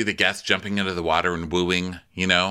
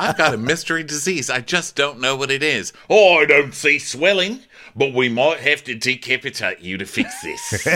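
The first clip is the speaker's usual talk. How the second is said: hammed-up doctor; 6 semitones higher, 5 dB louder than usual. Host parodying the doctor captain. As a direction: play a clueless doctor dead serious. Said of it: "parody doctor captain".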